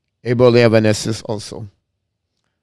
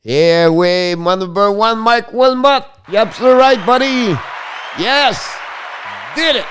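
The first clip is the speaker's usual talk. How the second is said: much higher, louder, thrilled cheering; excited shout-out; 9 semitones higher, 3 dB louder than usual. Real, not acted. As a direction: greet your number one fan with delight. Excited shout-out to a favourite viewer.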